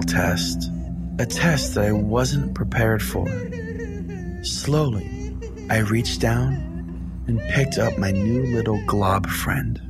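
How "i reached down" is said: ordinary talk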